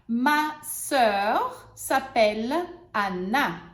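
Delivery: slow, clear example; teacher models the sentence. Shown as a demonstration. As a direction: model it slowly. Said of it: "vamos usar os exemplos agora".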